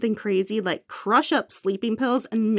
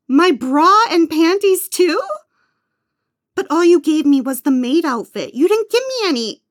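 loud, fast, high protest; indignant outburst; 5 semitones higher, 5 dB louder than usual. Acted. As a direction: snap back in outrage. Indignant at demand to strip.

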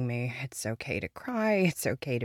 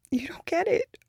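a high, tight whisper; whispered frustration; strained whisper; 13 semitones higher, 6 dB louder than usual. Acted. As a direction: force it out under your breath. Grief turning to frustration.

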